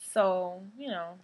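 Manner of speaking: ordinary delivery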